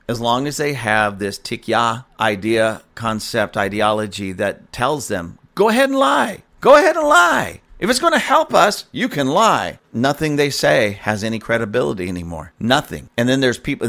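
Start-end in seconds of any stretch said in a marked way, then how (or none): 5.5-9.8 much higher, louder mock voice; mocking sing-song permission. Mocking the ideology telling believers to lie, much higher and louder. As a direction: chirp it like a cheerful license to lie.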